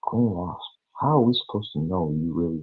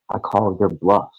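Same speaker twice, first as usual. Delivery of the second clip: loud challenge; 4 semitones higher, 9 dB louder than usual. Real, not acted. Heated rant at rival streamer.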